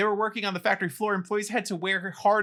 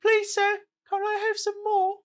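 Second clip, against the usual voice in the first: high, pleading British orphan voice; hammed-up Dickensian urchin, much higher pitch; Oliver; 14 semitones higher than usual. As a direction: beg politely in a high little British voice. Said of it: "It sounded like Oliver".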